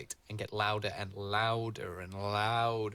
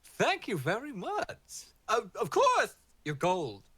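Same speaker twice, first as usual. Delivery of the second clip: high, loud, drawn-out gracious voice; grand green man; 10 semitones higher, 5 dB louder, slower than usual. Acted. The green man thanking the party, high and grand. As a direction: thank them with theatrical grandeur.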